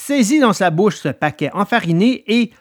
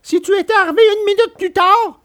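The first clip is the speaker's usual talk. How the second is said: much higher, scared puppet voice; frightened puppet. A narrator's reading. Pinocchio answering Alidor, high frightened voice. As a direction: shudder at the thought of being late. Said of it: "qui tremblait encore de peur".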